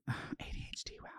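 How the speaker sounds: fast unvoiced whisper; sheepish aside to herself. Real, not acted. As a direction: mutter it under your breath quickly. Self-aside about own distraction.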